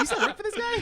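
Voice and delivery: mock high-pitched friendly hello; Pete Davidson voice. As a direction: lightly mimic a goofy roommate. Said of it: "retelling the clip".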